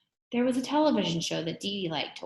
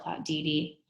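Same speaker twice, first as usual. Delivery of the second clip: usual speaking voice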